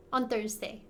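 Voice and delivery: normal talking voice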